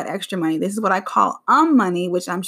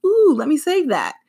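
eager interjection; 7 semitones higher, 3 dB louder than usual. Retold or imitated. Retelling her reaction to husband.

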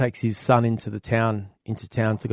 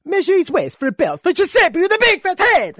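put-on loud, high-pitched hammy Italian accent; cartoonish Italian father, pitched way up; Italian guy; 8 dB louder than usual. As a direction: bellow it as an excitable Italian customer. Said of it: "And then the Italian goes".

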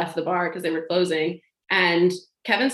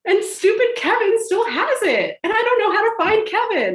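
much higher and louder, a whiny rant; comic whine; 5 dB louder than usual. Real, not acted. Complaining to the hosts about Kevin, high comic whine.

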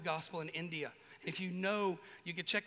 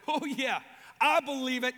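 loud, higher mocking disbelief; sceptical scoff; 5 semitones higher, 8 dB louder than usual. Thomas scoffing at the disciples' news, loud and sarcastic. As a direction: scoff at their claim.

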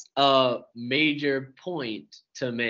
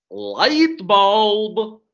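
higher, louder cartoon villain accent; Gru; 4 semitones higher, 5 dB louder than usual. Shown as a demonstration. Showing off another accent for a friend, Gru impression. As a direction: put on a hammed-up cartoon foreign accent. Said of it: "Oh, gross!".

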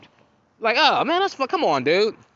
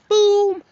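mock boom; 5 dB louder than usual. Doors shutting.

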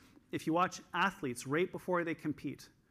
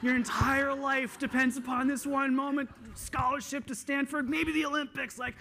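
much higher, loud, hyped-up coach shouting encouragement; fired-up pep talk; 10 semitones higher, 5 dB louder than usual. Retold or imitated. Young coach firing up Katie before her race, loud and hyped. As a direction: pump her up like a frantic young coach about to send her into the race. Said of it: "and I was like".

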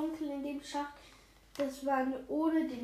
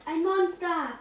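louder, higher startled cry; frightened shout; 5 semitones higher, 10 dB louder than usual. A narrator's reading. Edgar spots a monster on the TV, frightened shout. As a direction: yell it out in sudden fright. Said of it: "schrie Edgar hinter ihm erschrocken".

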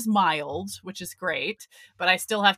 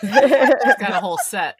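louder, said through laughter; laughing; 8 dB louder than usual. Real, not acted. Chatting about the comedian, laughing. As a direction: laugh the words out.

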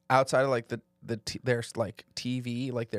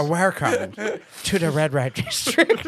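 mock tour guide; 3 semitones higher, 5 dB louder than usual.

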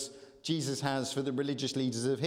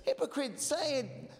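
urging; 9 semitones higher than usual. Real, not acted. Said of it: "Everyone say hypocrites".

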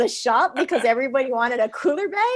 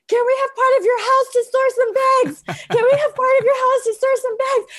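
much higher, louder frantic begging; panicked pleading; 6 dB louder than usual. Retold or imitated. Calling family frantically, panicked high pleading for storage. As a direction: beg in a panicked squeal. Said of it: "I was hyperventilating".

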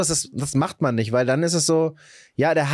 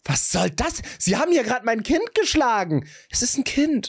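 higher mock-indignant mother; outraged mother; 7 semitones higher than usual. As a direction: act the protesting mum, breaking into laughter. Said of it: "kommt die Mutter wieder und sagt".

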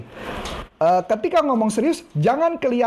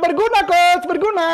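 cheer it out; 9 semitones higher, 4 dB louder than usual. Shown as a demonstration.

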